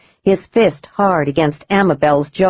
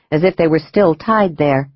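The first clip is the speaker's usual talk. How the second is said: ordinary delivery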